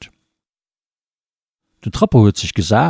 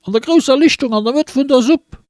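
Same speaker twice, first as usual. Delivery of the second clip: much higher, excited street calling; villagers calling out; 12 semitones higher than usual. Acted. Villagers shouting the news through the streets, high excited calls. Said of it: "hun se gerufen".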